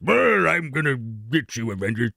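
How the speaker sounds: slow, growled villain threat; bad guy. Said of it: "some bad guy".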